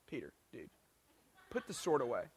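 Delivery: very low, soft deadpan scolding; hushed mock rebuke. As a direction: quietly voice a disapproving look. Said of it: "one of those looks like".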